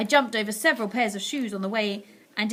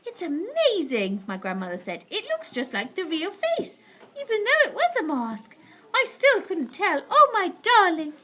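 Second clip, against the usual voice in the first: higher, marvelling; grandmother voice; 8 semitones higher, 4 dB louder than usual. A narrator's reading. Grandmother marvelling at the mask. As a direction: wonder aloud.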